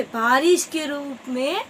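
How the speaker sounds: ordinary delivery, nothing marked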